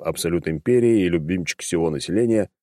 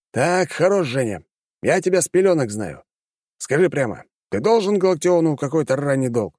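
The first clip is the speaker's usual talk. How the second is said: put-on stern old man; 7 semitones higher than usual. A narrator's reading. Pushkin pressing Alekseev.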